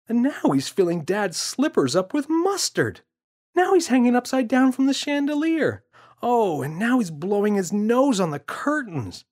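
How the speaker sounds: put-on boy's voice. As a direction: kid reporting the mess, building up. James keeps describing.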